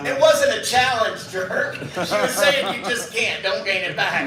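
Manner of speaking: half-laughing as you chew him out; husband snapping at a friend for a rude joke; exasperated husband. Retold or imitated. Husband scolds speaker.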